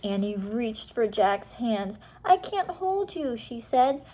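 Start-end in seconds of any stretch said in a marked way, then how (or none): 2.2-3.4 high straining cry; desperate girl. Acted. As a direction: strain to hold on. Annie straining to hold Jack.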